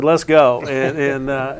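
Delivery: usual speaking voice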